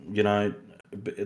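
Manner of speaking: ordinary talk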